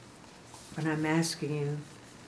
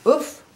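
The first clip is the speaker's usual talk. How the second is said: oof; 10 semitones higher, 10 dB louder than usual. Acted. Piggy falls.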